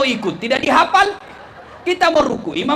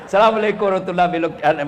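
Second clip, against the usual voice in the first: salam penutup salat; 7 semitones lower, much faster than usual. Acted. Acting out the closing prayer salam.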